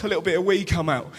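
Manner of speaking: usual speaking voice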